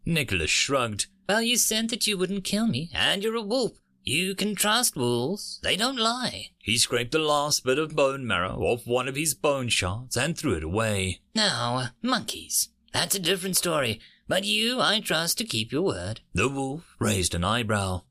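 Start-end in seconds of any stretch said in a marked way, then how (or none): 1.3-6.5 high, earnest character voice; trusting young human. Acted. Nicholas speaking in the story. 11.3-16.2 high, earnest character voice, quick in places; trusting young human. Acted. Nicholas speaking in the story.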